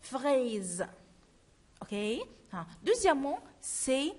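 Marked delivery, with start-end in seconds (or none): 0.0-0.9 FRAISE with a long buzzing z at the end; teacher restating FRAISE with voiced z; corrected voiced ending. Shown as a demonstration.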